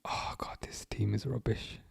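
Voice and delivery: hushed whisper of disgust; whispered despair. Real, not acted. Fan watching the match whispers in despair. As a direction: breathe it out under your breath. Muttering despair at youth team.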